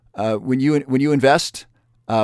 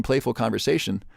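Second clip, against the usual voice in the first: normal talking voice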